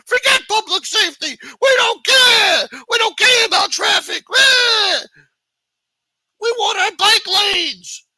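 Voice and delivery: high, very loud mocking screech; drawn-out, squawking mock shouts at top pitch; mock protest barking. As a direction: shriek it like a braying mob of council-meeting loudmouths. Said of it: "They fucking bark the loudest".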